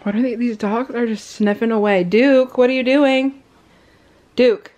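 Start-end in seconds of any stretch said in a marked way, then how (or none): 2.0-4.7 calling the dog. Real, not acted. Owner calling her sniffing dog Duke, raised call. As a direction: call Duke across the room.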